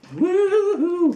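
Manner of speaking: drawn-out falsetto rock whoop; high whoop. Retold or imitated. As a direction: let out a high playful woo-hoo. Imitates the singer's whoops.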